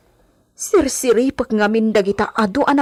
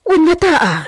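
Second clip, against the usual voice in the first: high laugh through the words; nervous laugh; 8 semitones higher, 5 dB louder than usual. Acted. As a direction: laugh off the fear. (kinakabahang tawa)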